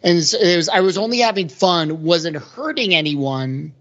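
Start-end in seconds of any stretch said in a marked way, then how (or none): none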